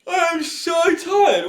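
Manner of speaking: high, slow drawn-out moan; mock tired whine. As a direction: whine that you're exhausted.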